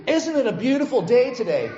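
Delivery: bright small talk from the neighbour; cheerful neighbour. Retold or imitated. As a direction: play the sunny optimist greeting the Mainer. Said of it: "Talk to any Mainer about the weather".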